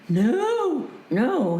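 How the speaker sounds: small high-pitched frightened answer; scared, reenacted. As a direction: answer like a scared girl held aloft, acted. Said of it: "I'm just so scared".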